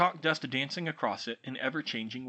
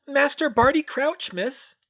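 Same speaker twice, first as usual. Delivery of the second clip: very high, loud trembling elf squeak; timid house-elf greeting a stranger, quivering squeak; 13 semitones higher, 9 dB louder than usual. Acted. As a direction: squeak it out, small and nervous. Winky answers.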